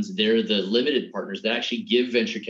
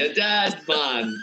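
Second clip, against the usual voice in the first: slow, higher, put-on Jamaican accent; bad Jamaican accent; 5 semitones higher, much slower than usual. Dad quoting his daughters' favourite movie in a bad Jamaican accent. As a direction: drag it out in a hammed-up Jamaican accent. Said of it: "that's a horrible accent".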